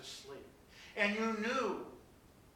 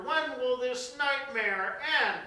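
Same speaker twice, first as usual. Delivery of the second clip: loud, slow, anguished question; rising preacher's lament; anguished cry; 8 semitones higher, 9 dB louder, slower than usual. Acted. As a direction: cry out to heaven. Preaching climax.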